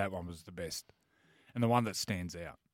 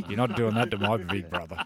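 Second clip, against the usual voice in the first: laughing mock threat; 7 dB louder than usual. Protective little brother, laughing.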